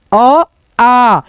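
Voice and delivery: अ short with a small mouth, then आ long and open; short then long vowel. Shown as a demonstration. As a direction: contrast अ and आ by mouth opening. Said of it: "मुंह कम खुल रहा है".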